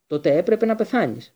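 loud, furious outburst; thundering shout. A narrator's reading. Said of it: "βροντοφώναξε ο Μπλακ".

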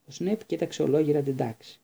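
plain reading voice

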